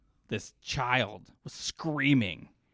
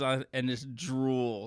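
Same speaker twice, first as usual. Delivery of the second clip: ordinary delivery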